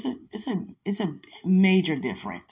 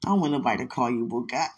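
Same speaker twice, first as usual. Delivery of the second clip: usual speaking voice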